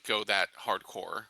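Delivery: ordinary talk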